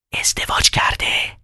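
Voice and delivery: secret whisper. Acted. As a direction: whisper it behind his back. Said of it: "زن یواشکی از ریوخین پرسید". (نجوای پنهانی)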